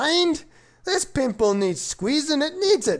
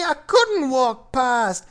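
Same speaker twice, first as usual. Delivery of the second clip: put-on storytelling in a Scottish accent; slower than usual. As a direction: recite the rhyme in a Scottish accent. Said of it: "with his magnificent Scottish accent".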